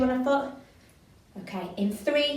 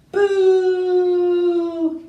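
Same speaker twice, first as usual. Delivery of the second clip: loud high booing shout; audience boo; 8 semitones higher, 10 dB louder than usual. Shown as a demonstration. Teacher demonstrates a panto audience boo, loud and high. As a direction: boo the villain with full force. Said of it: "shout one of those things".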